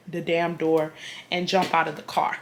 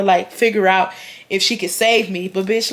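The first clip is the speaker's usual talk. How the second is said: ordinary talk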